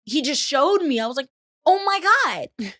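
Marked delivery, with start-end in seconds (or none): 1.6-2.7 high, slow drawn-out gasp; scandalised. Retold or imitated. Shown the picture, slow high scandalised gasp.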